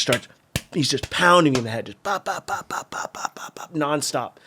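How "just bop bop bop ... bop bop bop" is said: rapid, soft popping bops; light percussive punch sounds, fading; imitated punches. Storyteller miming blows to his head, quick soft bops.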